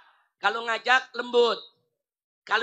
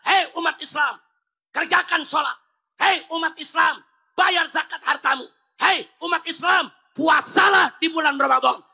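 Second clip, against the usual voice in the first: firm command; 4 semitones higher than usual. Shown as a demonstration. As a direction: command the crowd with authority. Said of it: "Itu disebut amar ma'ruf".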